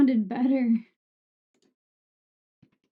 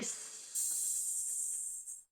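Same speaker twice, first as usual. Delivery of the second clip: harsh s; 21 dB quieter than usual. Shown as a demonstration. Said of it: "if I go, like".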